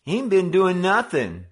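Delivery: high, loud whiny protest; cornered bully whining. Acted. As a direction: wheedle your way out of trouble. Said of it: "Simpson whined".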